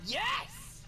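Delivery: high burst of delight; a squeal of glee at the tune; thrilled yes. Real, not acted. Gamer hears the music she hoped for, thrilled yelp. Recognises favourite game music.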